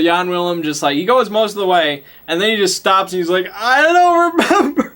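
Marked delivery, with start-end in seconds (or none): 3.5-4.9 much higher, slow, dopey admission; sheepish high voice. Acted. Guide admitting he forgot the way, slow high sheepish. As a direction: drag out the confession sheepishly.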